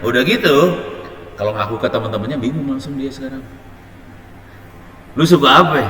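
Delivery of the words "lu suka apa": a loud, high schoolyard question; asking loudly. Retold or imitated. A schoolmate loudly asks the child where he's from. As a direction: call out the question like a kid's friend.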